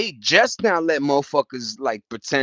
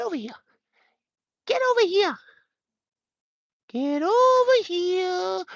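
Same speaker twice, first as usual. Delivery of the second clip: put-on much higher meowing baby voice to the cat; 3 dB quieter than usual. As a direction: coax the kitten with tiny high meows. Calling his cat to play.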